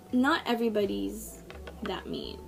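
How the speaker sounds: ordinary talk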